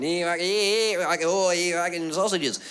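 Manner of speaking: whining Australian gibberish chef; Australian cartoon-chef babble; Aussie chef. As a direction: do a sweary chef in broad Australian. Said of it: "what an Aussie chef would have sounded like".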